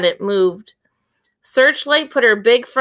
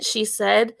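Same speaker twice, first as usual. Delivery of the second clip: ordinary delivery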